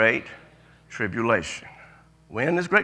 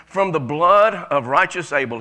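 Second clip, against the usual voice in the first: slow emphatic reading; 4 dB louder than usual. Real, not acted. Reading scripture slowly.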